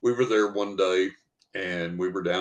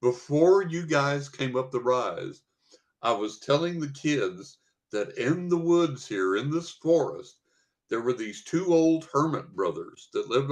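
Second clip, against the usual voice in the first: a light imitation of the teacher, higher and giggly; laughing teacher; 6 semitones higher than usual. Teacher explains the hermit story to the hikers, lightly imitated, higher with laughs. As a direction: retell her story with laughter bubbling through. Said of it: "and she said".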